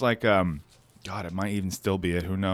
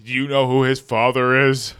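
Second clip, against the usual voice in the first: hammed-up dramatic reveal, louder; soap-opera drama; 7 dB louder, slower than usual. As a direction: ham it up like a soap villain.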